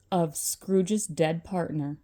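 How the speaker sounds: ordinary delivery, nothing marked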